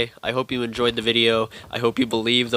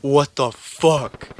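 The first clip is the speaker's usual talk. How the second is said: higher, louder, slow drawn-out disbelief; a drawn-out stunned what the fuck in the dark; 3 semitones higher, 5 dB louder, much slower than usual. Retold or imitated. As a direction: stretch it out, completely shocked. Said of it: "And I'm like".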